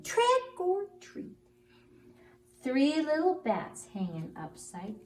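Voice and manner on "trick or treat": very high, slow sing-song call; high drawn-out spooky call; high squeaky trick or treat. Acted. Bat says trick or treat.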